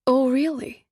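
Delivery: put-on Eastern European accent, surprised lift on really; light accented surprise; truly surprised. A narrator's reading. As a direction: genuine surprise at someone never having been here. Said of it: "Katya sounds truly surprised".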